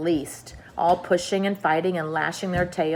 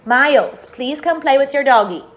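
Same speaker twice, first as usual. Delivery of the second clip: louder, higher call to her son; 7 semitones higher, 8 dB louder than usual. Real, not acted. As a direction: call across the room to Miles. Calling her son over.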